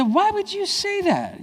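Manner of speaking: high, slow, stunned question; a father stunned by his son's words, high and drawn out; shocked dad. Retold or imitated. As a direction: disbelief at what the boy just said.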